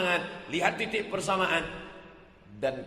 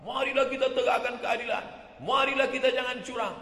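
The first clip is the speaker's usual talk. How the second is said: bold preaching call; 9 semitones higher than usual. Retold or imitated. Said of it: "kenapa lantang bicara".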